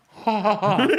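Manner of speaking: mock giggle. Mocking the vaping kids' laugh.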